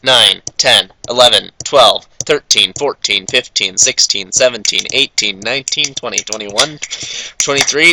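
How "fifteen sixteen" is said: usual speaking voice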